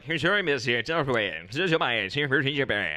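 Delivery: English-sounding gibberish in singsong swings; fake English. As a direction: imitate a foreigner's idea of English. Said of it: "impersonating what English sounds like to a non-English speaking".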